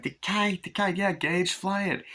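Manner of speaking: put-on high eager child voice; toddler voice. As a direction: squeal for the kite like a small boy. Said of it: "And Gage is like".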